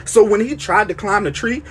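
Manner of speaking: ordinary talk, nothing marked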